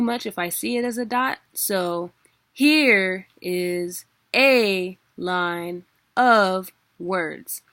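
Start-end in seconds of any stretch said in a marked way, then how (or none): 2.5-7.6 "here", "a" and "of" loud and long, very slow; heavy drawn-out beats, strong word then lighter word; stressed syllable demo. Shown as a demonstration. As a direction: hammer out "here is a line of words" on HERE, A, OF. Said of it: "Where we're putting the stress and the emphasis".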